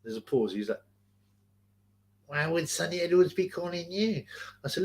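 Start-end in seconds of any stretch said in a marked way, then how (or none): 2.3-4.2 higher weaselly imitation; put-on high, weaselly denial. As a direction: play the caller feigning innocence in a weasel voice. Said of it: "I can hear your fucking weasel voice".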